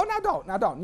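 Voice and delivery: imitating a fiancée's teasing protest; playful protest.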